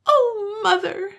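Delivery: put-on high sobbing cry of a little girl. Little girl crying to her mother, sobbing high. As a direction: break into a sob on each word. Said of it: "she sobbed".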